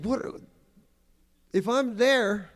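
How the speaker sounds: normal talking voice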